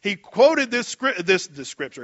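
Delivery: ordinary talk, nothing marked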